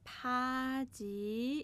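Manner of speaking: پاجی stretched very slowly with a long rising ending; drawn-out model word. Shown as a demonstration. Teacher stretches a Korean word so students catch it.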